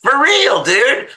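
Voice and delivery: incredulous shriek. Retold or imitated. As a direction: squeal out the outrage at his advice. Reacting to go to bed.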